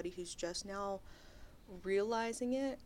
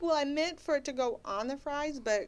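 sassy; 7 semitones higher, 6 dB louder than usual. Retold or imitated. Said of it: "And I was like".

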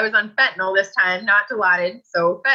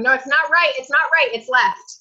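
raised, pleading insistence; urgent insisting; 6 semitones higher than usual. Retold or imitated. Patient urging the surgical team to operate on left, urgent pleading. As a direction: insist they get the side right.

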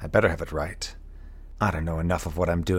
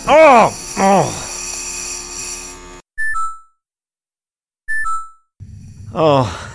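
loud, much higher cries of alarm; panicked cries; 11 semitones higher, 6 dB louder, slower than usual. Acted. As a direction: cry out as everything goes wrong. Pod failing, panic.

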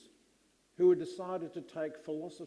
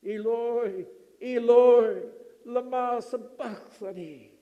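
anguished cry in Aramaic, the second Eloi much louder; heart-rending cry; 4 semitones higher, 12 dB louder than usual. Acted. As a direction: cry out to God from the cross. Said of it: "the heart-rending cry".

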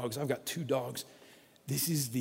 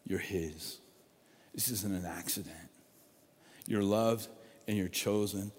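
tender reassurance; 8 semitones lower, 6 dB quieter than usual. Real, not acted. Reassuring the congregation.

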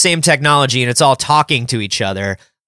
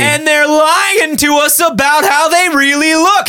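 nineties stand-up comic; 10 semitones higher than usual. Retold or imitated. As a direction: ham up a cheesy club comedian. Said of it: "said a nineties stand-up".

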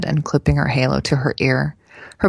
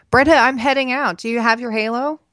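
raised, much higher motherly call; 9 semitones higher than usual. A narrator's reading. Mother heading out checks on daughter, calling out.

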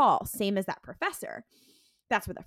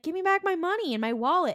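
high, louder demand; angry demand; 9 semitones higher, 4 dB louder than usual. Retold or imitated. Dean demanding his wallet back from Sam, angry. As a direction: insist he hand it over now.

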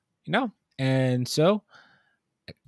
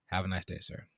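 lower, softer polite farewell; quiet; 8 semitones lower, 17 dB quieter than usual. Retold or imitated. Clerk quietly dismissing the rude customer, low and soft. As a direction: say it under your breath, politely. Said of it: "you just scream".

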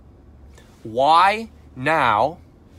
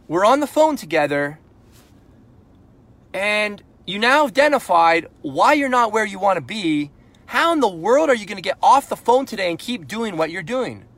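frustrated; 5 semitones higher, 4 dB louder than usual. Shown as a demonstration. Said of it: "a degree of frustration in your voice".

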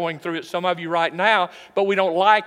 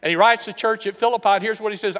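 usual speaking voice

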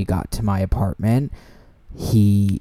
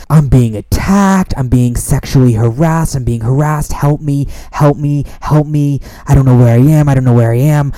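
loud, high panicked cries for help; panicked yelling; 4 semitones higher, 7 dB louder than usual. Retold or imitated. Drunk guy yelling to friends on the phone, panicked shouting. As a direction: yell it like you're under attack. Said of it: "he starts yelling".

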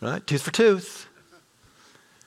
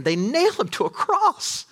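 high, building sermon peak; preacher building to the cross; climactic preaching; 8 semitones higher than usual. Acted. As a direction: rise to the climax.

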